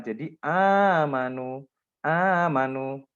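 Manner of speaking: teacher modelling amanu, long and slow; drawn-out amanu. Shown as a demonstration. Quran teacher demonstrating a long vowel, drawn out. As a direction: stretch amanu slowly, long first a. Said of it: "dibadalkan menjadi".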